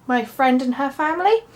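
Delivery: normal talking voice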